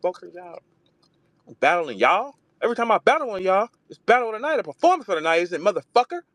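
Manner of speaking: high mock voice, fast and boastful; high, fast mock brag; imitating Jazz barking back. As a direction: play the cocky rapper taunting rivals. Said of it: "barking back at y'all like".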